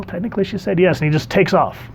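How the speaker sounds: usual speaking voice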